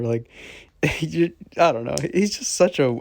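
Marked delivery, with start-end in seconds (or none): none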